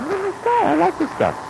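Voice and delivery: high, bubbly exclamation of liking; mock delight. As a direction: gush to prove you are friendly. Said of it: "And I say".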